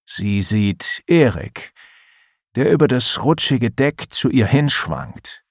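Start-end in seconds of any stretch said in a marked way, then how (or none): none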